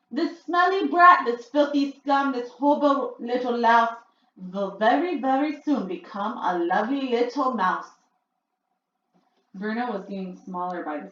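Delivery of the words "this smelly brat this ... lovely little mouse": shouting out. A narrator's reading. Grand High Witch gloating over Bruno as he shrinks, shouting. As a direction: chant the insults with glee. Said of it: "she shouted out".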